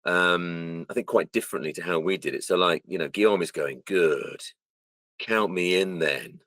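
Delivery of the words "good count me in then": slow, drawn-out imitation of a line reading; slow delivery. Imitating how the mocap actor said the line, slow. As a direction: stretch each word out unhurriedly. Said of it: "a lot more slower".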